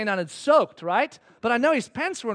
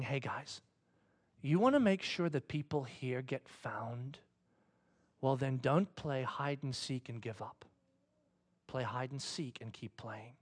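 low, soft, slow exhortation; hushed, earnest call; gentle appeal; 8 semitones lower, 11 dB quieter, slower than usual. Retold or imitated. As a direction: urge them quietly, with long pauses. God gently calls the church.

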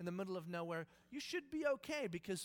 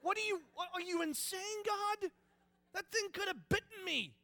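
much higher and louder, hammed-up fright; startled panic; 11 semitones higher, 7 dB louder, slower than usual. Moses panicking at God as the staff becomes a snake. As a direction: yelp it like you nearly got bitten. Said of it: "Moses is going".

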